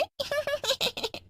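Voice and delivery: high fading witch cackle; trailing wicked laugh; evil cackle. Acted. Goat witch finishing her threat, fading evil cackle.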